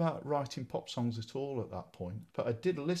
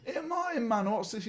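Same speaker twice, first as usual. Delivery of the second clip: high, excited lad calling out; 13 semitones higher than usual. Retold or imitated. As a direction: call out to him like a schoolmate. Big lads talking to him.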